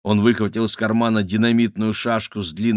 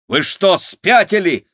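loud, high indignant outburst; angry; 9 semitones higher, 6 dB louder, slower than usual. A narrator's reading. Igor confronts the bandits, loud angry outburst. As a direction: blast them in anger. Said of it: "рассердился Игорь".

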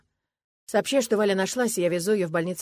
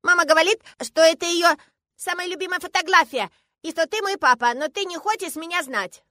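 put-on high, loud childish voice; insistent little girl; 12 semitones higher, 9 dB louder than usual. A narrator's reading. Girl telling the man he is her father, accusing. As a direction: press the point like an accusing five-year-old.

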